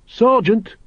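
urgent high-pitched call. A narrator's reading. Carrot trying to warn his sergeant, urgent. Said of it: "Carrot repeated urgently".

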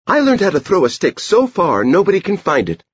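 put-on high-pitched child character, boastful. A narrator's reading. Edie boasts to the dog about throwing, high girlish voice.

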